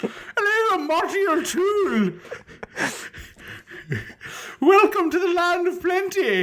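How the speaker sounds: very high Scottish-tinged leprechaun voice; Sean Connery. Acted. Connery undercover as leprechaun Marty O'Toole, hammed-up high accent. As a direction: put on a squeaky mock leprechaun with a Scottish accent. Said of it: "Sean Connery with his very thick Scottish accent trying to be like a f-, friggin' leprechaun".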